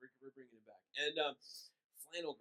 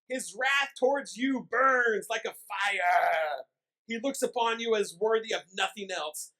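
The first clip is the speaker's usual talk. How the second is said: fire-and-brimstone preaching; 4 semitones higher, 9 dB louder than usual. Acted. Pastor quoting Edwards to the congregation, thundering hellfire preaching. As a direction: boom it out like an old revival preacher. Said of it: "this is what Jonathan Edwards says in this sermon".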